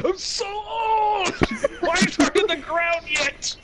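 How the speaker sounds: put-on high, slow, shouted old man voice. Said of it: "And I look like a frail old man".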